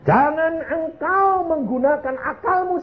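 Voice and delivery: very high, slow, drawn-out admonition; forceful warning. Real, not acted. Preacher warning against one's own reasoning, in high, slow exhortation. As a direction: proclaim it slowly at a high preaching pitch.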